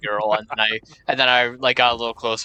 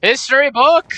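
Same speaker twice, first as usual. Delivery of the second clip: high loud call; excited shout; 13 semitones higher, 9 dB louder than usual. Real, not acted. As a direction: shout it out gleefully. Calling out items.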